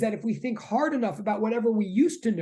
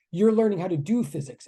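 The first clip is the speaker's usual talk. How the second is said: ordinary delivery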